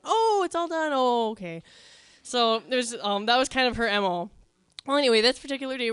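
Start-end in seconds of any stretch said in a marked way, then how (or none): 0.0-1.6 higher, innocent mock surprise; mock innocent older sister acting surprised; fake surprised sister. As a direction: play dumb, as if just noticing the chores are done. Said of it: "she'd come out of the bathroom like".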